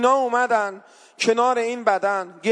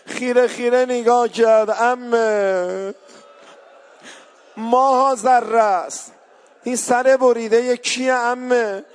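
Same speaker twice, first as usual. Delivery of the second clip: louder, slow mourning sermon voice; impassioned lament preaching; 4 dB louder than usual. Acted. Preacher recounts the orphan girl's grief to mourners, loud and slow. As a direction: pull the mourners into weeping.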